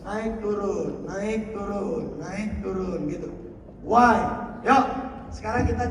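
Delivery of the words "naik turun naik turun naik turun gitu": "naik turun" slow, rising then falling. Shown as a demonstration. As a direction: draw out "naik turun" like a wave. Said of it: "guru yang hebat memainkan sequence". ("naik turun" pelan, naik lalu turun)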